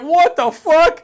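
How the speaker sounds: high, loud, drawn-out disbelief; a loud stunned what the fuck; shocked yell. Retold or imitated. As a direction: scream it in total disbelief. Shocked reaction retold.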